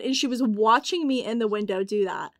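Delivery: ordinary delivery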